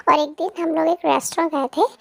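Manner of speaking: ordinary delivery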